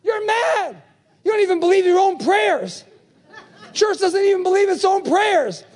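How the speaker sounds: high shouted disbelief; scoffing church. Retold or imitated. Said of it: "and they say".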